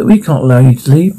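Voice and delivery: ordinary talk, nothing marked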